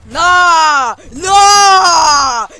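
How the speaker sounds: very loud, high, drawn-out wail; anguished shout. Real, not acted. Player crushed by a loss, anguished wailing shout. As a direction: wail each word out, breaking up after. Loses, cries out in despair.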